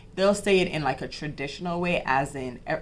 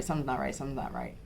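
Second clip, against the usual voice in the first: trailing off quieter and lower each time; fading repetition; 4 semitones lower, 8 dB quieter, much faster than usual. Retold or imitated. New mother pleading with doctor's office, fading to near silence. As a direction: let it sink away, quieter with each repeat.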